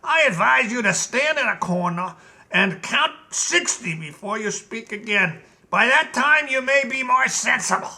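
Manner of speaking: a lightly voiced, calm commanding general; stern general. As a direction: scold the king coolly. General Blug lectures the king.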